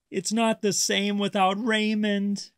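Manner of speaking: high, sulky pout. A narrator's reading. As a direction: sulk it out like a sad little shark. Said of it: "Gilbert pouted".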